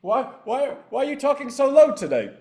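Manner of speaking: hammed-up baffled assistant asking why; mock Ted voice. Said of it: "You're like".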